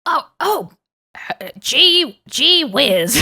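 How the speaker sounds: high cartoon boy with a country drawl; squeaky Ash Ketchum going country. Doing Ash Ketchum country for the hosts, high drawled cartoon voice. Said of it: "if I could do Ash Ketchum country".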